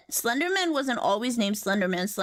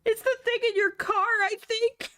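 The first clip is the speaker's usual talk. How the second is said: squeaky high joke voice; high silly voice; 12 semitones higher than usual. Acted. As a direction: pitch way up for the punchline.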